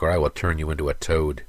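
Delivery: plain reading voice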